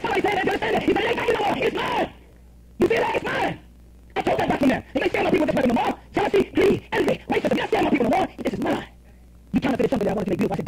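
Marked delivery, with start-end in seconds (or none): 0.0-8.9 high-pitched, fervent sermon delivery; impassioned pulpit proclamation; full-force preaching. Acted. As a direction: preach it high, driving each declaration home.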